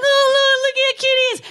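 high, gushing coo; mock adoring coo; cooing over a lamb. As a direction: squeal like you've seen a baby lamb. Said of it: "I'm like".

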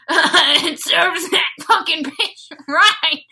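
slow, high, loud, laughing through it; angry laughter. Shown as a demonstration. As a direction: laugh it out in a spiteful rage. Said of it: "which I guess would sound like".

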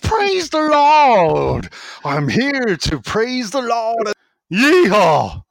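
hammed-up American preacher; mad Deep South preacher. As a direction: bellow like a Bible-bashing Deep South preacher. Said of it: "I'm working on an American accent".

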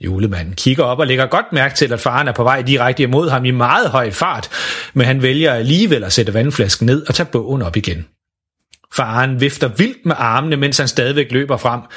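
plain reading voice

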